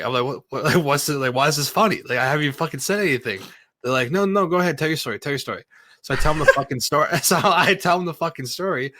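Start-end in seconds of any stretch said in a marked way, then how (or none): 0.2-3.4 higher-pitched, incredulous protest; retold indignation. Challenging laughing coworkers before the story is told, retold indignation. 4.1-5.6 higher-pitched imitation of eager coworkers; coworkers egging on. Coworkers urging the teller to go on, imitated egging on. 6.0-8.9 high-pitched, talking through a laugh. Real, not acted. Telling friend how coworkers heard the story, laughing.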